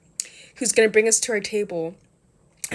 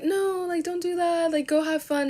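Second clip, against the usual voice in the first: higher, insistent plea; pleading with friends; 9 semitones higher than usual. Retold or imitated. Urging her group to stay at the club, higher pleading voice. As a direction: push them to go, pitch up, earnest.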